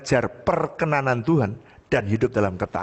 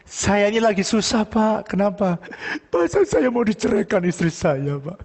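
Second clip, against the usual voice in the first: higher-pitched acted plea; miserable neighbour; 8 semitones higher than usual. Troubled neighbour confides his wife wants a divorce, miserable. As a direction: imitate a distressed man pleading. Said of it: "karena dia lagi sengsara ya".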